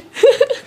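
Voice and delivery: high little laugh; short laugh. Real, not acted. Actress wraps up her answer to interviewer, short high laugh. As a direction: break into a brief, high giggle.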